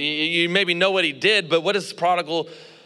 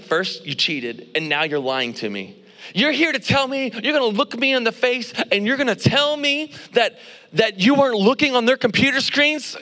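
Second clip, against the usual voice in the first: indignant, mad; 6 semitones higher than usual. Retold or imitated. Professor confronting a lying student, building indignation. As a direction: start measured, then rise into mock outrage. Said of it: "Dude, he got indignant. He was mad".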